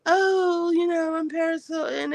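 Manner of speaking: high mock baby voice of Paris; lightly voiced ditzy heiress. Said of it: "that stupid baby voice she has".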